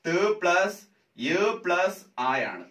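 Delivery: each sound laid out slowly; slow spelled breakdown. Shown as a demonstration. Teacher slowly spelling out ത് plus യ for students. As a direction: spell the letters out one by one for students. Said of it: "ത്യ എന്ന് പറയുന്നത് എന്താണ്?".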